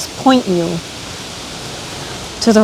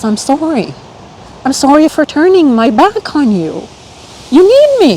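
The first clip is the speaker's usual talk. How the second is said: emotional self-apology, building higher; getting emotional; 5 semitones higher than usual. Real, not acted. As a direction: plead with yourself, voice rising as feeling takes over. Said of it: "I'm getting emotional".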